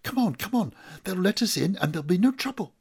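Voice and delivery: high, loud, rushed urging; hyped-up eager burst, pitched high; excited, hyper. A narrator's reading. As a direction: hurry them along, giddy with excitement. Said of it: "he says, really excited, hyper".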